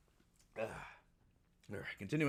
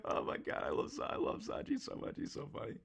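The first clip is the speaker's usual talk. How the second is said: breathless laughing gush; 5 dB quieter than usual. Real, not acted. Fan gushing after laughing.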